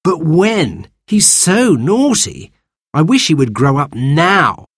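a fed-up brother whining; boy's voice. Acted. Cyril complains about Baby.

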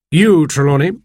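doctor lays out what he has heard; lightly voiced doctor. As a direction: speak as the measured, explaining doctor.

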